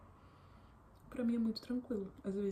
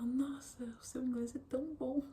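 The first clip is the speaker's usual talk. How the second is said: imitating an amazed older lady, higher and a bit louder; impressed praise; 6 semitones higher, 3 dB louder than usual. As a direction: gush over the English like a delighted host.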